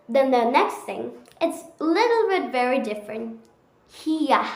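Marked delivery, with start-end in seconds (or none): none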